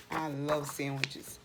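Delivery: usual speaking voice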